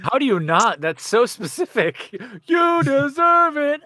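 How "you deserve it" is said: high, slow, tearful voice; teary echo of the crowd's words; choked up. Acted. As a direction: get through it on the verge of tears. Player echoes emotional crowd chant.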